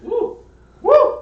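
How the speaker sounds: very high, rising whoops; whooping cheer. Real, not acted. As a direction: let out gleeful hollers. Player cheers.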